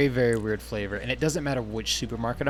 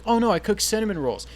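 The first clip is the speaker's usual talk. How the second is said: mock dismay; 9 semitones higher, 3 dB louder than usual. Retelling being caught.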